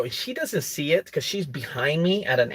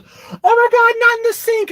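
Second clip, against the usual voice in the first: much higher, louder imitated shriek; mock shriek; 10 dB louder than usual. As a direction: shriek it as if horrified. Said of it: "she goes".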